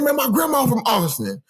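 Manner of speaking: high, eager retold exclamation; excited discovery. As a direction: imitate a man thrilled to find a connection. Acting out seller's excited discovery.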